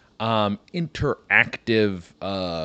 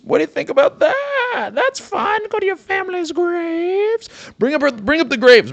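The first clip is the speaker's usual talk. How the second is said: manic shout; 5 dB louder than usual. Acted. Guy pitching graves as fun.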